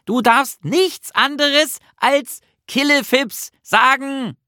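high, slow, word-by-word cry; exasperated shout. A narrator's reading. Nisse tells Bertil the only word allowed, shouting slowly. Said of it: "schrie Nisse".

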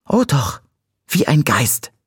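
put-on high-pitched girlish objection; contradicting. A narrator's reading. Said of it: "widersprach sie". (hoher Widerspruch)

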